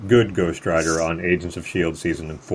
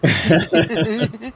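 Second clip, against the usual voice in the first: laughing; 13 semitones higher, 3 dB louder than usual. Real, not acted. Laughs at joke.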